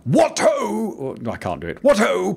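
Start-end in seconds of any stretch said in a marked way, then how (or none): 0.0-0.9 high, hearty mock exclamation; impression of a booming high greeting; imitated exclaimed greeting. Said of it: "very much an exclamation". 1.8-2.3 high, hearty mock exclamation; impression of a booming high greeting; imitated exclaimed greeting. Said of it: "very much an exclamation".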